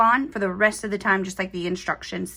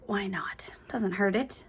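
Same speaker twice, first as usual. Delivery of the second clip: much softer, unvoiced aside; whispered aside; 2 semitones lower, 7 dB quieter than usual. Real, not acted. Aside while stitching.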